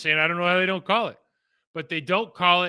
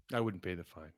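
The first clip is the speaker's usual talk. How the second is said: quiet aside; 9 semitones lower, 13 dB quieter than usual. Real, not acted. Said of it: "quiet aside".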